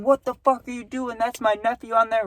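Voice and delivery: high, strained mock scream without full volume; retold scream. Uncle defending nephew from boat driver, mock screaming. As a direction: imitate an outraged uncle screaming across water. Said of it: "screaming at the guy".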